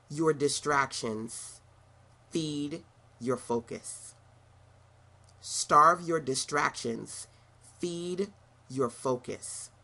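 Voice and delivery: slow, each word weighed; slow deliberate slogan. Shown as a demonstration. Speaker drilling a slogan slowly into listeners. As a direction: drag the slogan out for emphasis. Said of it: "commit to the following".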